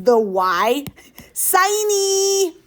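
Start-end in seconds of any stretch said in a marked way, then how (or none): 1.3-2.6 high, drawn-out whine on siny; whiny voice. Shown as a demonstration. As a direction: whine the word like a kid. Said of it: "you gotta do it in the whiny voice".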